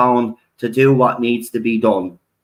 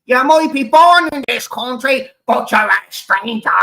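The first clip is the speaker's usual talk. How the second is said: mocking hypocrite; 11 semitones higher, 3 dB louder than usual. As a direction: ham up the locals rejecting outsiders. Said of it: "the very same fucking people that said".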